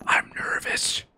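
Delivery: hushed, voiceless aside; nervous whisper. Real, not acted. Player admitting nerves mid-fight, nervous whisper. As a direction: whisper your nerves under your breath.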